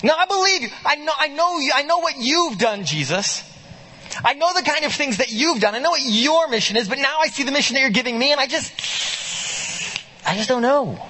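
lightly voiced worried follower; hesitant disciple. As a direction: plead your uncertainty to Jesus, voice lifted. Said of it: "a faith that says".

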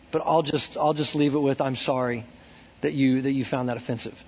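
gentle and careful, trailing soft; polite apology. Retold or imitated. Pastor apologizing to offended older man, soft. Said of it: "I said something like".

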